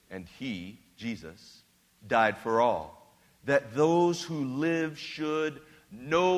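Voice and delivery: slow, hushed reading of the verses; scripture reading. Acted. Pastor reading Scripture to the congregation, slow and hushed. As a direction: let each line of scripture land slowly.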